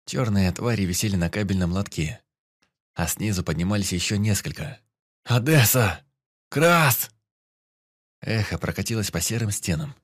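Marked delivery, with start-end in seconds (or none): 5.2-7.1 loud, high calling out; shouting names. Acted. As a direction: yell for them across the shaft. Calling comrades in the dark.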